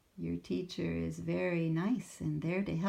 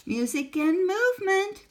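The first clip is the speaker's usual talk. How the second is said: much higher, louder call to the class; teacher calling out; 14 semitones higher, 11 dB louder than usual. Acted. Teacher calls the class to music time, loud and high. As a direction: announce it brightly. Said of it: "called the teacher".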